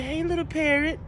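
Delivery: high sugary call to a parrot; sweet greeting. Retold or imitated. Lady cooing to the parrot, high and sweet. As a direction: coo up at the bird.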